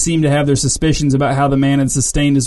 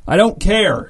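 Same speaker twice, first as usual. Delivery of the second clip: shouted; 6 semitones higher, slower than usual. A narrator's reading. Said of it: "shouted the man".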